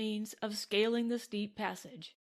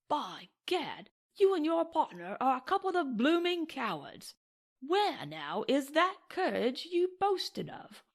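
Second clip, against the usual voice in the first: aroused anger; 6 semitones higher than usual. Acted. Castleton fully aroused, accusing.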